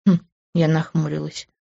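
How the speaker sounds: usual speaking voice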